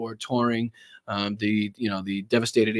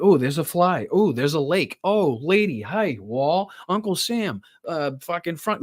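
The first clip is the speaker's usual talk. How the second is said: mock scatterbrained rambling, each item popping up higher; imitating rambling president; 5 semitones higher than usual. Mocking the president's short attention span, lightly voiced. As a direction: hop from thing to thing like a distracted old man. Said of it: "to show everybody just how incoherent".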